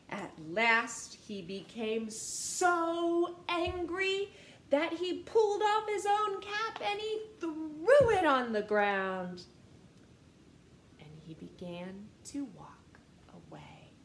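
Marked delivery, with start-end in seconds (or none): 1.3-9.4 angry build. A narrator's reading. Narrating peddler's anger. 11.0-13.9 hushed. A narrator's reading. Peddler gives up.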